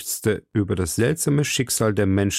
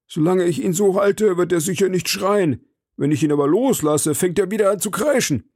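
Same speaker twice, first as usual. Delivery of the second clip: the servant's voice, higher and proud; eager servant; 7 semitones higher than usual. Acted. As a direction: boast to the master, higher.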